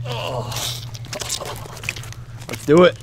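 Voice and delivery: usual speaking voice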